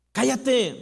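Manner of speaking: loud, high "Cállate"; crowd's shout. Retold or imitated. The crowd silencing the blind man, loud. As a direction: snap it at him. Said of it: "muchos le gritaban".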